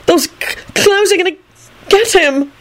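high, stammering resignation; sighing. A narrator's reading. Melvin fearing for Tim, sighing. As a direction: catch on the consonant, then sigh it out. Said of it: "Melvin sighed".

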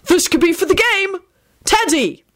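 high, urgent excited call; high-pitched excitement; excited bellow. A narrator's reading. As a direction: call it out high to rally the group. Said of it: "Arthur bellowed excitedly".

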